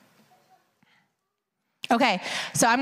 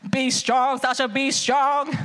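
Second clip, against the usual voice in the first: how he talks to me. Retold or imitated. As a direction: speak it close, just to her. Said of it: "he comes out like".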